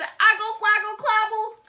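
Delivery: put-on high, loud toddler babble; baby babble. Toddler Trixie babbling to her daddy, high and loud. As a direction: babble like a baby who can't talk yet. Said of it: "She turned to her daddy and said".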